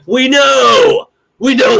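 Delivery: mock fed-up cry; exasperated reply.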